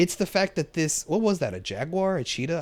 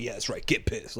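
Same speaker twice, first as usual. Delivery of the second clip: imitating Kraven; 6 semitones lower, 9 dB quieter than usual. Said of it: "I do like how he talks".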